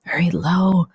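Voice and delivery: husky, whispery imitation of a weak voice; mock papery, breath-heavy voice; Biden's papery whisper. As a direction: half-whisper it, dry and papery, imitating a failing speaker. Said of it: "in this weird papery voice".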